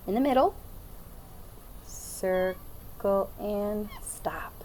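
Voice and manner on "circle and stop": drawn-out circle and stop, softer; slow, soft guiding voice; slow, soft demo. Shown as a demonstration. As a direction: stretch it out quietly as you draw. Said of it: "Do it with me this time".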